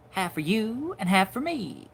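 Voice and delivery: high, singsong old-time cartoon delivery; musical line read. Shown as a demonstration. Animator demonstrating his chipmunk cartoon line, high and musical. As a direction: lilt it loudly, holding long high notes. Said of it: "a little musical quality to the voice".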